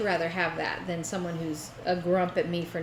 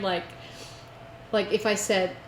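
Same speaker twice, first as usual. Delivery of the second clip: usual speaking voice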